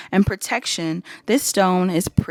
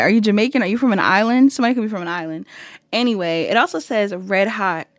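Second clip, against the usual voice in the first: normal talking voice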